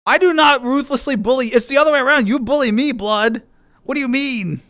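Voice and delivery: high-pitched playful protest; mock indignation. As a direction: protest your innocence with hammed-up outrage. Mock-denies bullying, accuses friend back.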